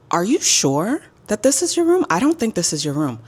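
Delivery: loud, high mock disbelief; suspicious Karen. Roommate doubting a newcomer's right to the room, mock Karen. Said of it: "start acting like a Karen".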